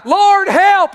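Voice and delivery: desperate loud cry for help. Acted. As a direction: cry out to the Lord, pleading. Preacher voices the cry.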